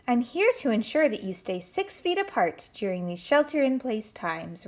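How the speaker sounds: usual speaking voice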